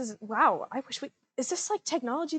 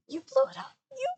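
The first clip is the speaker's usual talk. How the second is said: pitched up in shock; stunned realisation; 12 semitones higher, faster than usual. Acted. Character repeats it in disbelief, startled. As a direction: blurt it out, disbelieving.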